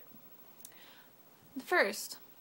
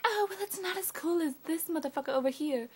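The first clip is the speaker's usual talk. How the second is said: high-pitched mock boasting; mocking imitation; 6 semitones higher than usual. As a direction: mimic a jealous phone owner, pitched up. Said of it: "they were like".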